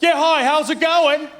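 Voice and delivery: put-on barge in with a loud American accent. Tourist storming into a shop, loud brash American accent. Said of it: "in the loudest American accent".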